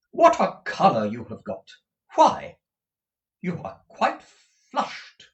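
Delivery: loud, pointed motherly exclamation; emphatic mother, word by word; exclaiming Mrs. Nickleby. Acted. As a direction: remark on it with loud surprise.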